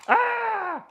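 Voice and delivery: high excited squeal. Real, not acted. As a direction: let out a giddy high squeal. Excited squeal about Metroplex.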